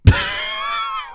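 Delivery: very high shrieking laugh. Real, not acted. Host breaks up mid-rant about Biden, shrieking laugh. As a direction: let out a long, piercing burst of laughter.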